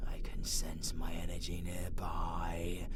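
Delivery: quiet intent whisper; hushed whisper. Acted. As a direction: whisper while sensing. Villain senses magic, hushed.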